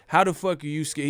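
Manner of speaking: usual speaking voice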